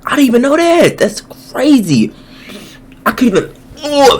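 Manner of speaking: high-pitched astonished reaction; stunned, high exclamations; shocked amazement. Real, not acted. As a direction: halt in disbelief, voice pitched right up. Shocked at fridge being removed.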